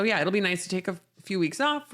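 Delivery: ordinary talk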